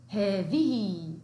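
slow teacher modelling; slow drawn-out model word. Shown as a demonstration. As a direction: stretch each sound slowly. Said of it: "ما هو اسم الإشارة للمفرد المؤنث؟".

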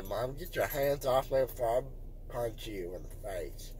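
low, soft, slurred mumbling; drowsy mumble. Real, not acted. Drowsy mumbling after anesthesia.

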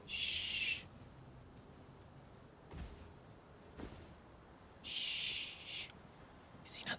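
breathy hush, no voice; whispered shush. Pretend. Sneaking past enemies in game.

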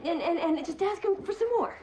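high, stammering urgency; panicked demand. Acted. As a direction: push the demand out in a high, frantic voice. Wife panics, demands they ask donor.

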